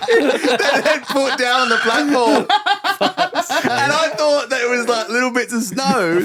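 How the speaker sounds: can barely get it out for laughing; real giggling through the punchline; laughing while talking. Real, not acted. Laughing at own salt story.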